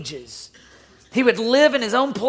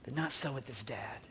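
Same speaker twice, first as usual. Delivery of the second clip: whispered and much softer, lower; a breathy hushed turning point; dramatic whisper; 8 semitones lower, 16 dB quieter than usual. Real, not acted. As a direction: hush it to turn the story. Preacher turns point in whisper.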